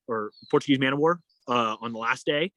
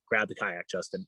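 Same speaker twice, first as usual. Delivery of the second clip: low, quiet retold order; dead serious; 5 semitones lower, 7 dB quieter than usual. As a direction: give the order straight-faced, no room for argument. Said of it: "dead serious, like, straight face".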